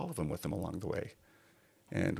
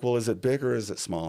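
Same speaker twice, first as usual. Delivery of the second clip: brisk benefactor; 6 semitones higher, 4 dB louder than usual. Retold or imitated. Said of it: "She's like".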